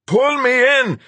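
desperate yell for help. Acted. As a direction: bellow it in panic. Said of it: "he yelled".

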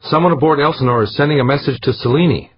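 high, loud urgent report. A narrator's reading. Crew member warns of a message being sent to Selene, urgent report.

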